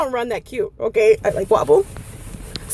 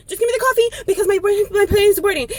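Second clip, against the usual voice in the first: frantic demand; 7 semitones higher than usual. Retold or imitated. Late customer demanding the coffee.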